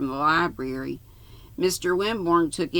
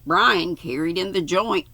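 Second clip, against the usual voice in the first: ordinary delivery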